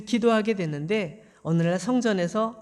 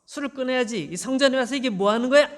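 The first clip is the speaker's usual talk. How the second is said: angry shouting; 3 semitones higher than usual. Acted. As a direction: yell at her to sober up. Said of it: "막 이렇게 소리를 지른 거죠".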